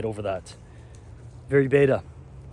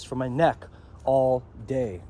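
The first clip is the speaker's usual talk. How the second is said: ordinary talk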